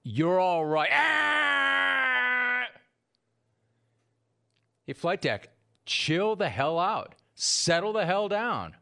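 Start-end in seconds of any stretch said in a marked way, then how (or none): 0.9-2.7 a sharp, high-pitched yelp; a startled, far-off shout; sudden high shout. Real, not acted. Shout at the replay. 4.9-8.7 slow, stern "chill the hell out"; the host reining in his producer; slow scolding. Real, not acted.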